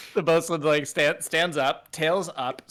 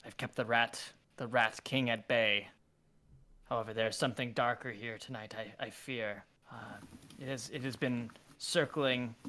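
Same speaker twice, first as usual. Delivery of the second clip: hushed, low, ominous royal voice; grim cat king; 4 semitones lower, 8 dB quieter than usual. Acted. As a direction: speak of a lurking beast. Bosun speaks in fear.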